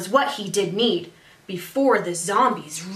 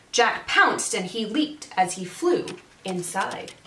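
ordinary delivery, nothing marked

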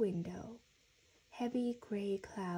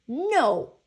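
loud, higher-pitched refusal; angry, very loud shout; defiant yell; 4 semitones higher, 14 dB louder than usual. A narrator's reading. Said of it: "he yelled".

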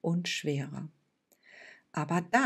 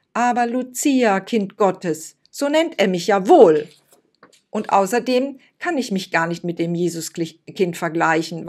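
angry; 3 semitones higher, 9 dB louder than usual. Acted. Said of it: "Da wurde Lucia aber auch ganz schön wütend".

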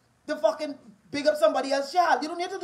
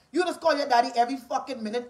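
usual speaking voice

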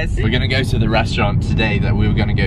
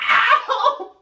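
mock cry of pain; 3 dB louder than usual.

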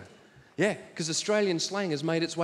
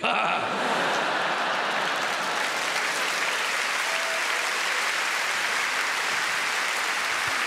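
breathy laugh. Real, not acted. Laughing at own joke.

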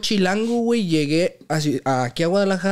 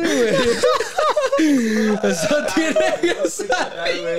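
much higher, slow words forced out through laughter; laughing; 14 semitones higher, 3 dB louder, much slower than usual. Real, not acted. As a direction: try to speak while cracking up. Laughing at friend's fart. (hablando entre risas)

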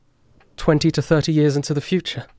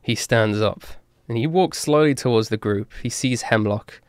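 usual speaking voice

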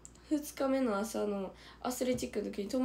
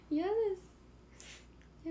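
whiny refusal; 9 semitones higher, much slower than usual. Real, not acted. Refusing to make a funny face.

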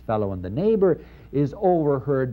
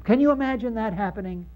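higher and fast, disbelieving; incredulous challenge; 10 semitones higher, faster than usual. Real, not acted. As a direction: fire it at them in disbelief.

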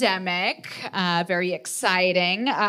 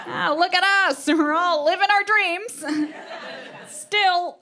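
higher, laughing mock-chipper voice; much higher, giggly fake brightness; sarcastic mock cheer; 10 semitones higher than usual. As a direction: pretend to celebrate a life gone sideways. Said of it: "it's like".

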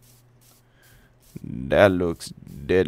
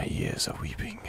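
breathy whisper, much higher, tongue in cheek; mock whisper; 10 dB quieter than usual. Player mocking relief from sheep noise, whispered. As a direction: whisper it like a melodrama.

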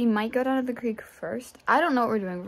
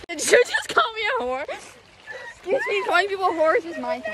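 mock offended; 11 semitones higher than usual. Mock offense at friend's jab.

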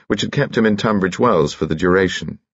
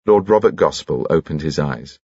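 usual speaking voice

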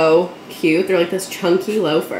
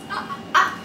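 breathless giggle; 14 semitones higher, 3 dB quieter than usual. Real, not acted. Giggle after the squeal.

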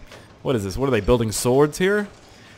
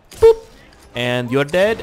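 ordinary delivery

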